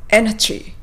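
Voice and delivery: エナジー, lips pouted, pointed sound; sharp English energy; アヒル口でエナジー; duck-lip pronunciation. Shown as a demonstration. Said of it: "唇をアヒル口にして発音すると".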